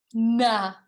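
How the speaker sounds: loud stubborn nah; Bartholomew refusing. A narrator's reading. Bartholomew refusing George, loud stubborn nah. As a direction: dig the heels in. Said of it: "said Bartholomew".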